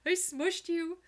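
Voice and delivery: high cooing apology to a tiny dog; baby talk to dog. Retold or imitated. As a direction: coo sorry to the pet you sat on. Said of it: "I'm like".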